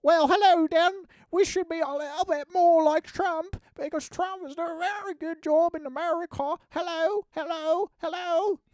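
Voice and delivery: very high, loud mock posh British voice; squeaky British politician parody; British politician impression. Streamer mocking a British politician, high squeaky British accent. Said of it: "I've seen a British politician being like".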